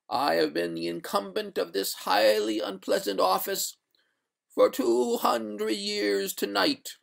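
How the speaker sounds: high, drawn-out spectral voice; stately, wailing spectre; doleful water ghost. Acted. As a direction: intone the lament high, stretching the phrase endings.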